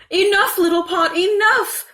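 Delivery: high, loud frantic plea; panicked cry. A narrator's reading. Mother trying wrong words on the overflowing pot, panicked and high. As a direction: desperately try to stop the pot. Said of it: "cried the mother".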